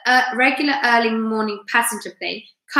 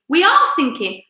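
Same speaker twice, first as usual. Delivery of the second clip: higher, firmer contradiction; insistent; 7 semitones higher, 4 dB louder than usual. A narrator's reading. Characters argue about sinking.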